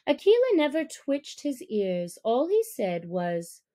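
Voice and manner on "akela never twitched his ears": ordinary delivery, nothing marked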